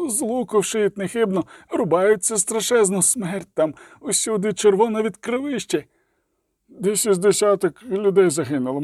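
high, panicked babbling report; terrified report. A narrator's reading. A mercenary reports the slaughter to his coroner, panicked. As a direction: spill out the horror in a rush of fear.

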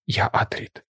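hushed, voiceless whisper. A narrator's reading. Paul answers Stilgar about taking a new name, whispered. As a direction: whisper it under the breath. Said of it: "прошепотів Пол".

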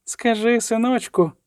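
high, tender motherly coaxing; gentle voice. A narrator's reading. Gentle voice coaxes the dying killer, high and tender. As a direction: coax him sweetly, as if to a son. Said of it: "повторював лагідний голос".

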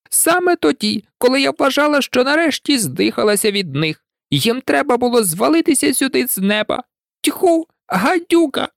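the pigeon loses it; rising to a shout. A narrator's reading. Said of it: "переходячи на крик". (переходить на крик)

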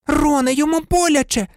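high squeaky cry; squeaking Hermione. A narrator's reading. Hermione squeaks at Ron to stop hurting the cat. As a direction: squeak it out in alarm. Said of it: "писнула Герміона".

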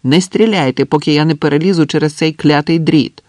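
loud, high-pitched protest; shouted plea. A narrator's reading. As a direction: shout to hold their fire. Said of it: "крикнув він".

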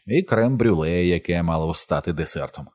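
plain reading voice

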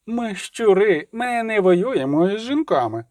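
high, slow mimicking taunt; mocking echo, pitched high; grinning mockery. A narrator's reading. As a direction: parrot it with a toothy grin. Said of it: "знову вишкірив зуби Келі".

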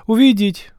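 high, loud call to come in; shouted call. Acted. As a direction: call through the door. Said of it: "крикнула Деяніра".

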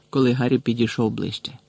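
plain reading voice

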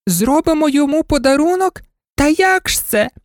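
very high, incredulous doll squeal; mocking dolls. A narrator's reading. Dolls scoffing at the dog's idea, very high and amused. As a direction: echo it back high, as a scoffing question. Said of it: "зареготали ляльки".

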